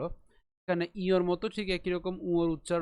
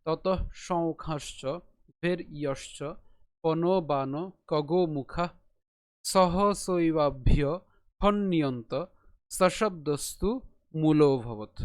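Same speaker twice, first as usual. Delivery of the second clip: slow, louder chanted shloka; full recitation; 4 dB louder, much slower than usual. Shown as a demonstration. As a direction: recite the verse clearly. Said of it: "আমি আরেকবার বলছি". (শ্লোক স্পষ্ট সুরে আবৃত্তি)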